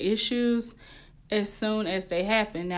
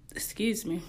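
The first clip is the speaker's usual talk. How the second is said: unvoiced, soft "excuse me"; whispered apology after a burp; 5 dB quieter than usual. Real, not acted. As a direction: breathe "excuse me" with no voice. Apologizes after burping mid-talk.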